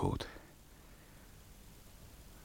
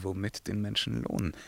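ordinary talk, nothing marked

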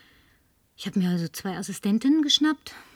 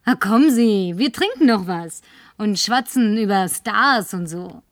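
laut und fordernd; lautes betrunkenes Fordern; 6 dB louder than usual. Acted. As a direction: den Barmann anherrschen. Betrunken fordert sie Drinks. (verlangt betrunken und laut nach der Flasche)

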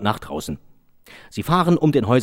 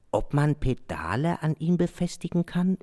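pondering cyclist; 6 semitones higher, 5 dB quieter than usual. Acted. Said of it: "überlegte ein Radfahrer".

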